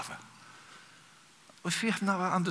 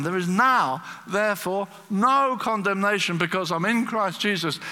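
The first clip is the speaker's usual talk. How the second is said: high, loud declaration of discovery; thrilled; 6 semitones higher, 6 dB louder than usual. Retold or imitated. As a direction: proclaim it with elated emphasis. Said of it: "He was so thrilled".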